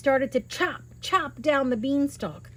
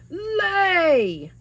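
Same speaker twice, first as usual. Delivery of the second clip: high, loud giant bark; giant's shout; 9 semitones higher, 6 dB louder than usual. Acted. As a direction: shout the order at the hen. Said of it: "the giant shouted".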